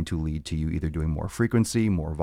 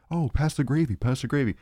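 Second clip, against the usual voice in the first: high, eager request for the gravy; eager guest at the table; 8 semitones higher than usual. Retold or imitated. Guest at Thanksgiving dinner wanting gravy, high eager voice. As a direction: ask like you can't wait for it.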